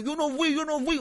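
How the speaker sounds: high, whiny imitation; mock a grown man pleading innocence; mocked childish denial. Thirty-year-old culprit denying it, high mocking whine. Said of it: "Un huevón de treinta años".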